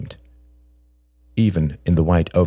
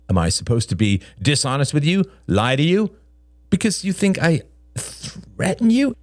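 high, climbing indignant question; defensive Greg; 10 semitones higher than usual. A narrator's reading. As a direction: protest in a higher man's voice, rising as it goes. Narrator voices defensive Greg.

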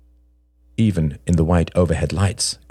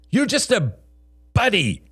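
high, accusing outburst; angry outburst. A narrator's reading. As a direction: hurl it at him with the pitch way up. John's thundered outburst continues.

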